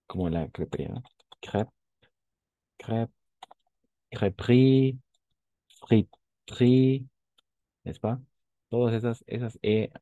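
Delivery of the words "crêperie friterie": crêperie and friterie said slowly, middle e dropped; silent e. Shown as a demonstration. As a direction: say friterie as frit-ri, higher. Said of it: "al medio son prácticamente mudas". (crêperie y friterie con la e muda)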